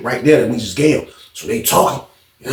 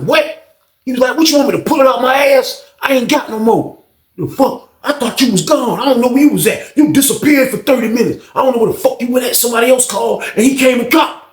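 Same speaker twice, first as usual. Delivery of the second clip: heated argument; 11 semitones higher than usual. Retold or imitated. Fred and old head shouting downstairs, high and heated. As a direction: argue hard and fast.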